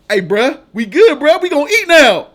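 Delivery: hammed-up excited creator; mock celebration. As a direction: cheer like a broke creator who just got paid. Voicing happy creator getting paid.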